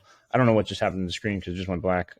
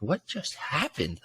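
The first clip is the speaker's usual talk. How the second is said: hushed, higher, drawn-out bewilderment; bewildered disbelief; 5 semitones higher, 8 dB quieter, slower than usual. Retold or imitated. Fan watching the Giants game, dazed and bewildered. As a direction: retell it softly and slowly, as if dazed. Retelling his bewildered reaction.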